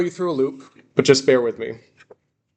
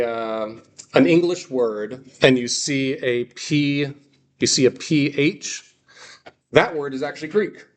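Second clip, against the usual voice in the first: ordinary talk, nothing marked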